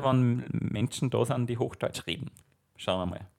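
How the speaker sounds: ordinary talk, nothing marked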